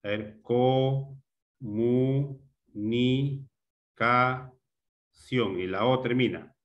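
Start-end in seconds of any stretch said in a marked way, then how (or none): 0.4-5.6 "co, mu, ni, ca, ción" in slow separate syllables. Shown as a demonstration. Teacher finding syllable nuclei for students, syllable by syllable. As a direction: break "co, mu, ni, ca, ción" apart with pauses. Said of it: "los núcleos silábicos son".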